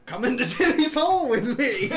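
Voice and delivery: laughing. Real, not acted. Teasing invitation through laughter.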